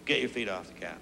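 very low, soft, understated order; simple calm request. Acted. Father to son on the couch, simple calm request. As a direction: say it quietly with no force at all. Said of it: "I simply say".

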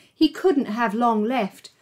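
plain reading voice

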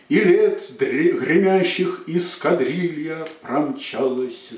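slow, sweeping verse declamation; dramatic poetry declamation. Acted. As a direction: declaim it slowly and grandly.

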